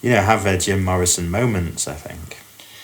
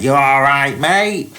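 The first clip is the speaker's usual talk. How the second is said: mock Cockney, pitched up high and loud; thick Cockney; 6 semitones higher, 4 dB louder, slower than usual. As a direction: play a cheeky London geezer with a thick Cockney accent. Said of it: "in a really thick Cockney accent".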